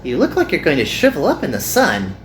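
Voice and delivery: cheerful Hikari. Acted. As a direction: ask kindly with a lift.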